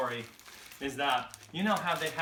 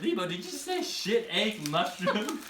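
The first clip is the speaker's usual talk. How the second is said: higher-pitched mock disbelief; incredulous question; 5 semitones higher than usual. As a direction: repeat it back in disbelief. Incredulous repeat of the wrong word.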